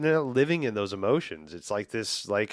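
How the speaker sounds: normal talking voice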